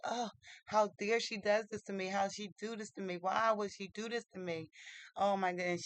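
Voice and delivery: ordinary delivery, nothing marked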